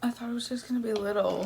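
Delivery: ordinary talk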